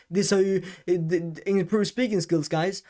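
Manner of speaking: mock of careless rushed speech; sloppy old delivery. Shown as a demonstration. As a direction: rattle it off with hesitations. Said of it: "instead of me just".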